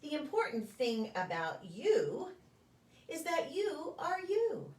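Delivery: gentle, sing-song picture-book reading with a lilting refrain; reading to children. Acted. Preschool story time reader to the children, gentle storybook voice. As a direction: read it like story time, drawing the little ones in.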